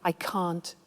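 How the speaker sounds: quiet, low, courteous refusal; gently apologetic objection; polite disagreement. Shown as a demonstration. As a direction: soften the disagreement, keep it polite. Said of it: "So it's better to say".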